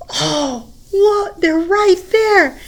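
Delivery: can't believe they're here; surprised cry. Acted. Ringu running toward friends, surprised cry.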